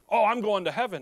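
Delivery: mock his breezy certainty; hammed-up confident reply; self-assured buddy. Acting out religious buddy's boastful answer.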